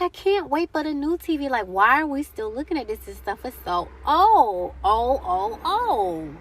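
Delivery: put-on childhood self. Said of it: "I'm like".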